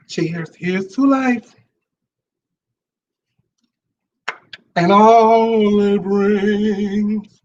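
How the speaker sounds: slow, stretched-out toast. Real, not acted. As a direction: draw each word out as you raise the glass. Raises a toast on camera.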